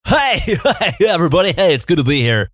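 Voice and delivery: high, cheesy car salesman greeting. Acted. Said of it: "that horrible, just cringy s-, car salesman voice".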